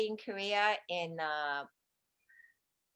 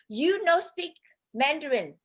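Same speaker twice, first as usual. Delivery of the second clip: higher, louder mock telling-off; retold scolding; 6 semitones higher, 4 dB louder than usual. Taiwanese lady rejecting his poor Mandarin, mock scolding. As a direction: imitate a lady bluntly turning you away.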